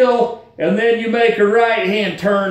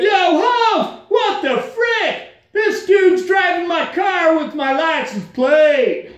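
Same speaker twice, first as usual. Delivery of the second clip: much higher, slow disbelief then a shout; outraged call; 8 semitones higher, slower than usual. Retold or imitated. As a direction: blow up at the tow guy. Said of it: "I called up the guy and said".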